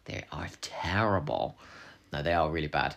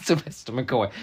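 normal talking voice

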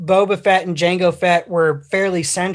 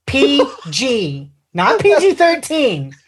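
"P G" spelled out high, letter by letter; emphatic correction; 6 semitones higher, slower than usual. Real, not acted. Podcaster correcting a co-host on the film's rating, emphatic. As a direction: stress "not P G thirteen" with raised pitch.

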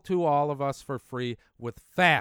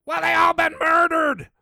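much higher, louder outraged cry; indignant shout; 12 semitones higher, 8 dB louder than usual. Real, not acted. Telling viewers good presidents were murdered, in a loud high outcry. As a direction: erupt with outrage.

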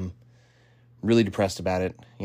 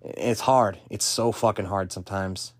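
normal talking voice

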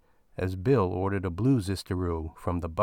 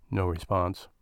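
plain reading voice

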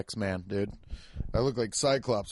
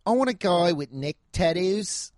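higher, mock Aussie contestant; Australian accent; 6 semitones higher than usual. Imitating Love Island ladies wanting a guy, in a higher Australian accent. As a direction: put on a high Australian accent. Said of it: "they're all like".

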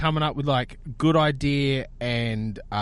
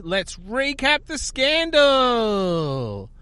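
slow, drawn-out mock host announcement; 6 semitones higher, much slower than usual. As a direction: stretch the last word right out, imitating a host. Imitates host announcing recap.

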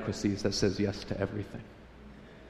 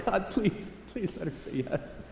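soft, high, acted teenage plea; hushed pleading prayer; 7 semitones higher, 8 dB quieter than usual. Teen praying at night about a crush, hushed mock pleading. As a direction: beg quietly, as if into a pillow.

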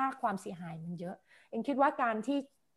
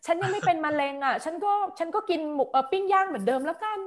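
carefree patient; 6 semitones higher, 5 dB louder than usual. Retold or imitated. Voices careless patient in example.

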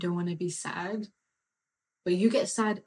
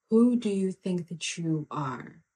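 usual speaking voice

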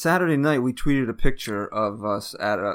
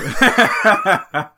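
giggle; 7 semitones higher, 7 dB louder than usual. Real, not acted. Laughing at the tease.

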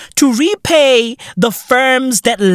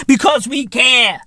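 usual speaking voice

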